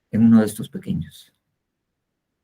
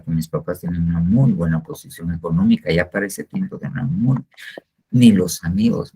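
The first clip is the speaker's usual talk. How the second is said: ordinary talk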